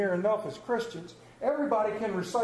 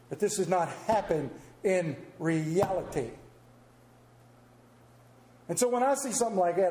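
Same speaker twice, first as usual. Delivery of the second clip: normal talking voice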